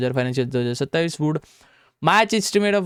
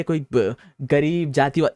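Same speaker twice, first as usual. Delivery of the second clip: ordinary talk, nothing marked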